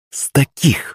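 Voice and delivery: high, spiteful comeback; snide retort. A narrator's reading. Countess answering her husband's complaint, snidely. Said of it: "ехидно ответила графиня".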